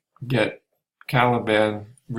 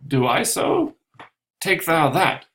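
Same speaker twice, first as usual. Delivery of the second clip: higher, louder angry retort; Stefano striking; 7 semitones higher, 4 dB louder than usual. Acted. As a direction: bark it as you strike. Stefano hits Trinculo.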